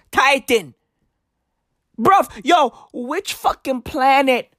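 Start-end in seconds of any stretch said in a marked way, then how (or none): none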